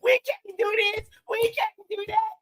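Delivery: high-pitched mock crybaby whine; mocking whiny crying. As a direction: imitate someone whining through every little complaint. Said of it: "that's baby whining".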